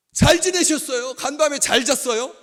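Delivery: high, booming hello to a neighbour; loud morning greeting. Acted. Preacher acting an early-morning greeter, booming and high. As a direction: belt out a cheery good-morning greeting far too loudly. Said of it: "큰 소리로 문안하는 거".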